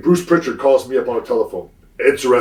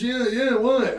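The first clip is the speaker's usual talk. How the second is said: much higher mock innocent voice; mimicking Bruce; 12 semitones higher, much slower than usual. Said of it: "He says".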